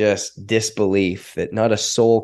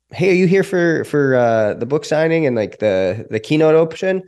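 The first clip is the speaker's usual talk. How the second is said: high-pitched, eager approach to strangers; retold hopeful call-out, pitched up; hopeful greeting; 7 semitones higher, 4 dB louder than usual. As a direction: check eagerly with a passerby.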